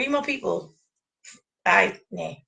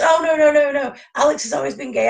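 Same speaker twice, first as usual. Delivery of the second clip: higher, louder imitation of protesting fans; mock insistence; 6 semitones higher, 4 dB louder than usual. Fan mimicking what everyone says about Alex, mock insistence. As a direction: rattle off an exaggerated mock denial.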